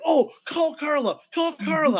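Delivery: high-pitched, loud excited outburst; super excited. Retold or imitated. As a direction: imitate a wife excitedly urging a phone call. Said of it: "got super excited".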